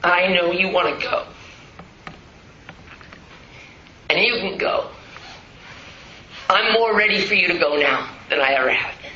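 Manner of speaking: soft, low words to a dying father; quiet farewell at a bedside; tender farewell. Retold or imitated. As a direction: gently let him go. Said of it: "and I said".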